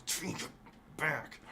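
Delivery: quiet, words pushed through clenched teeth; through gritted teeth. Acted. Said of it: "Don't make me talk through my teeth".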